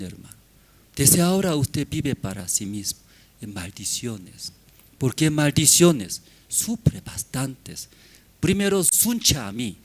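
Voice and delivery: ordinary talk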